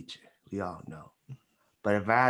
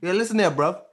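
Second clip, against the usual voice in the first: higher-pitched mock British accent; 7 semitones higher than usual. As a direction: do a playful Londoner's British accent. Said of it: "he got a good British accent".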